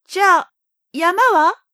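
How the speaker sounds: put-on high, hopeful little boy; young boy voice. A narrator's reading. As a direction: ask again, loud and eager. Prince asks again.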